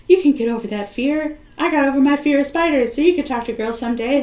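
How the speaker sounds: a hint of a peppy mentor; teacher voice. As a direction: encourage him like a kind teacher. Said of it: "his teacher is just like".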